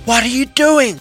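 high, panicky question; anxious stage whisper. A narrator's reading. Amy frets while loading the pistol, high and panicky. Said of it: "Amy whispered, her hands shaking".

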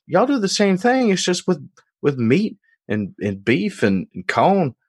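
put-on Jerome's Deep South accent. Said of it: "that's the accent".

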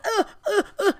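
high, whimpering stammer; whimpering panic. Retold or imitated. Trapped senior whimpering as the rat jumps at him, high panic. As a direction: whine like he's trapped. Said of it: "So now he's like".

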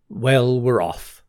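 loud, decisive announcement. A narrator's reading. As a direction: announce the departure firmly. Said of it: "said Mona decisively".

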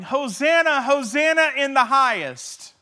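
loud, high triumphant shout; crowd shouting. Retold or imitated. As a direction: cry it out like a cheering crowd. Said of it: "the people were shouting".

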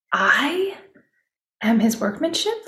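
I said high and apart, workmanship rising like a question; stress on I. Shown as a demonstration. Host asking listeners to feel the emphasis on I. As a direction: lift the word I, then ask. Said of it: "Does it land on the word I?".

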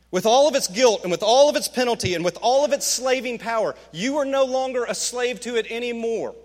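high, forceful declaration; impassioned preaching. Acted. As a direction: drive each phrase home from the pulpit. Voicing Paul's emphatic declaration.

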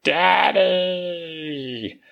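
high, childlike call of Daddy imitated; kid yelling across the house. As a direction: play the kid calling Daddy from another room. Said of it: "when my kids yell across the house and be like".

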